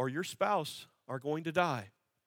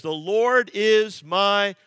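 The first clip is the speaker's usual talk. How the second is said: louder, slow lead-in for the congregation to finish; slow call-and-response line; drawn-out prompt; 3 semitones higher, 10 dB louder, much slower than usual. Acted. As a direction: stretch it out and wait for the answer.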